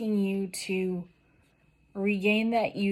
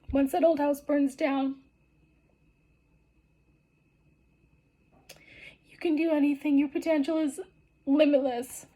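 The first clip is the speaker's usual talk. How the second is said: pushing through real tears, higher and higher; tearful; 7 semitones higher than usual. Real, not acted. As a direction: voice lifting high as tears well up. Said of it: "this is making me cry".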